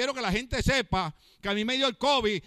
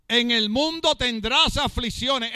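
preached quote; 2 semitones higher, 5 dB louder, slower than usual. Acted. First quote, preached loud and slow.

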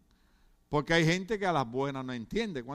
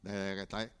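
bored shrug; 9 semitones lower, 9 dB quieter than usual. Retold or imitated. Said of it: "y dice".

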